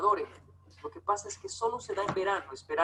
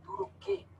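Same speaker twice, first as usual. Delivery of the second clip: higher, puzzled questioning; incredulous question; 10 semitones higher, 5 dB louder, slower than usual. Retold or imitated. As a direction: act baffled by the question. Mock dialogue about poverty.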